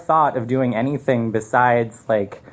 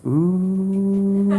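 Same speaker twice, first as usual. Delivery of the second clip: put-on a higher, drawn-out kids' ooh; hushed class ooh; 6 semitones higher than usual. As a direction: mimic a whole class going ooh. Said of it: "the class hushes and goes".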